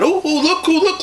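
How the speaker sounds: high, loud excited cry; excited shout. A narrator's reading. Girl shouting to Pa about the pretty cow, excited. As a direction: call out thrilled to see the cow. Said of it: "Laura shouted".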